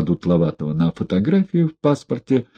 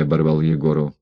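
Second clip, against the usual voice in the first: usual speaking voice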